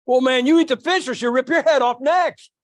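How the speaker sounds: waitress Ruth warning the customer; loud joking threat. Retold or imitated. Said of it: "She did".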